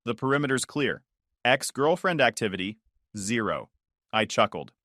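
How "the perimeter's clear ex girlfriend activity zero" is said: usual speaking voice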